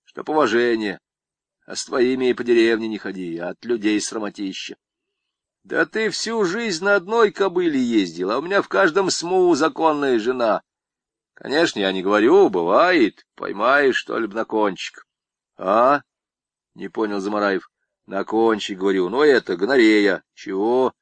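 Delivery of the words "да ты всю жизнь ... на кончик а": lightly voiced swaggering con, pitched up; boastful convict. Convict brags to Zamaraev about women, lightly voiced. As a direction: brag like a cocky camp inmate.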